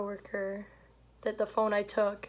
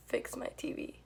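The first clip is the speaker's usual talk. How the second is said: a whispered, insistent order; quiet demand; 4 semitones higher, 8 dB quieter than usual. Retold or imitated. Retelling the old lady's quiet demand, softly voiced. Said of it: "And she's like".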